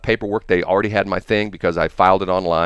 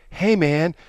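high-pitched casual hail to strangers; calling out; 10 semitones higher than usual. Retold or imitated. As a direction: call over to some guys, higher. Calls out to young skateboarders.

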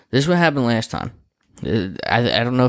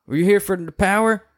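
high, light voice for the resident; 10 semitones higher than usual. Retold or imitated. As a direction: play the tenant asking. Resident voiced in retold story.

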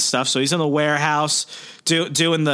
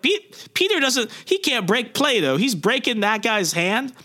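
much higher, mock-baffled voice; incredulous parents; 10 semitones higher than usual. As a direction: sound like you can't believe it. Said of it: "They're like".

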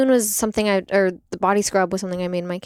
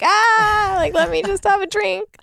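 much higher, louder playful protest; mock exasperated midwife; 13 semitones higher, 6 dB louder, slower than usual. Midwife begs her to let her enjoy a drink, higher playful protest. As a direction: plead for a break, playfully.

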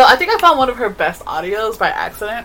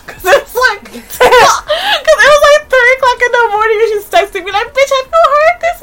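high-pitched laughing talk; laughing hard; 7 dB louder than usual. Real, not acted. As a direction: talk through a fit of laughter. Retelling late-night text, laughing.

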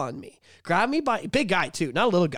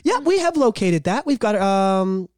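polite phone agent; 4 dB louder than usual. Acted. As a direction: switch instantly to helpful agent. Agent voice back to caller.

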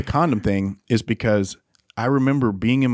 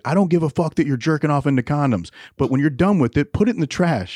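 imitating a fed-up dad, voice raised high; retold angry lecture, higher and insistent; so mad; 5 semitones higher than usual. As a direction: lay down the rule like a mad dad. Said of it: "he was, like, so mad".

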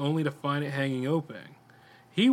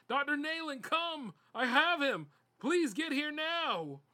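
screaming for help; 13 semitones higher, 4 dB quieter, slower than usual. A narrator's reading. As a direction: call out in a high strained voice. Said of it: "began screaming".

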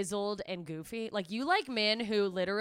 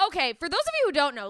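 ordinary delivery

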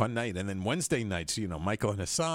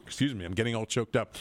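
ordinary talk, nothing marked

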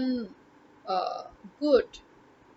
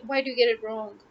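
normal talking voice

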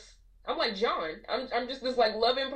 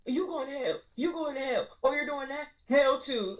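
raised, pointed condemnation at a sinner; lightly voiced preachy scold, wagging a finger; a hint of a hellfire preacher; mock judgmental preacher; 6 semitones higher than usual.

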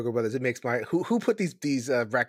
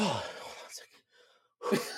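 winded, breathing hard between words; out of breath, gasping a little; 8 dB quieter, much faster than usual. Real, not acted. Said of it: "talk while I catch my breath".

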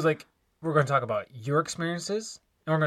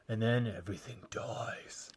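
sudden hushed whisper of doom; 5 semitones lower, 10 dB quieter than usual. Acted. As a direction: drop to a dark whisper. Gloomy punchline after cheery spring lines.

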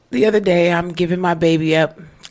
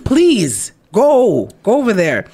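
shooing him away; 5 semitones higher, 6 dB louder, slower than usual. Retold or imitated. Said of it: "I'm like".

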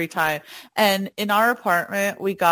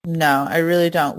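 ordinary delivery

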